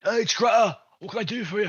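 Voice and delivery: high, loud put-on phone voice; Greta answering phone. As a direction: pick up the call as Greta. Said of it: "give 'em a ring".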